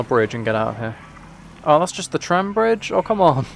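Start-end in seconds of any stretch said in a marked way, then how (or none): none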